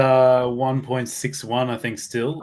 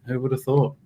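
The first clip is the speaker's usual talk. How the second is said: usual speaking voice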